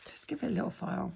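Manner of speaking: breathy unvoiced whisper, barely audible. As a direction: hush it right down like a secret. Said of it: "I know you find this really funny".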